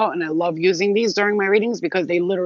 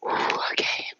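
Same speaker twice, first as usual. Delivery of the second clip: breathy excited whisper; whispered delight; 4 dB quieter than usual. Real, not acted. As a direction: hush it as if revealing a secret. Reacting to the cards.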